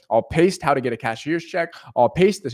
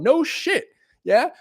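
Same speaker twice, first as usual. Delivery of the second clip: excited; 11 semitones higher, slower than usual. Real, not acted.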